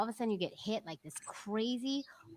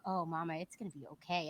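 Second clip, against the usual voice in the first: tender comfort; 4 semitones lower, 7 dB quieter than usual. Retold or imitated. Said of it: "Robin's like".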